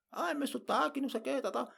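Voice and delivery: high, whiny mock fretting; mock whining. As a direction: parody a learner fussing over their accent. Mocks learners fretting over accent. (aluno reclamando do sotaque, lamento de brincadeira, agudo)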